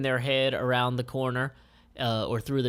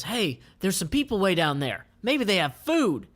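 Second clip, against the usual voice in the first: hopeful Jackie; 7 semitones higher, 3 dB louder than usual. Acted. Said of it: "Jackie is pointing downhill and says".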